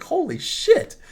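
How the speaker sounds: reliving astonishment, voice shot up high; stunned reaction. Retold or imitated. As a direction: squeak it out in retold disbelief. Acting out shocked discovery.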